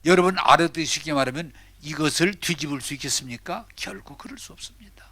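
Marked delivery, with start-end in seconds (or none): 3.8-5.1 whispered, breathy denial; hushed answer. Real, not acted. Preacher whispers to the congregation that it can never be. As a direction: drop to a whisper on 결코.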